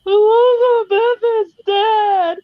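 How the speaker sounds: high, slow wailing grief, laughing through it; husband mock-wailing that the queen is dead, sobbing. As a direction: ham up a husband's fake despair. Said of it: "he goes, like".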